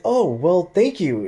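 mock-delighted thanks, pitch lifted; pleasantly surprised lady. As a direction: act out her pleased surprise. Delighted counter lady, retold.

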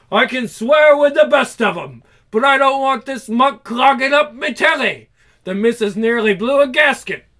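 loud working-class bluster; hammed-up indignant lorry driver; outraged lorry driver. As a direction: grumble like a fed-up trucker.

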